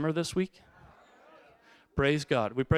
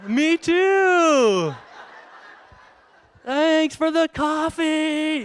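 sheepish reply; 9 semitones higher, 4 dB louder, much slower than usual. Retold or imitated.

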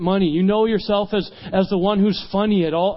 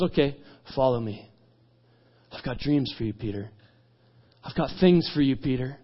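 soft, low, tender invitation; hushed, reassuring voice; gentle calling; 5 semitones lower, 5 dB quieter than usual. Retold or imitated. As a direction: draw them in quietly, with space between phrases. Jesus gently calls Peter.

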